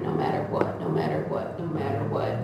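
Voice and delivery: fading to a low murmur; hushed vow. Retold or imitated. Telling God in her truck, hushed and low.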